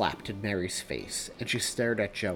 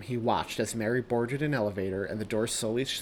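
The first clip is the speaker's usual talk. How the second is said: ordinary delivery, nothing marked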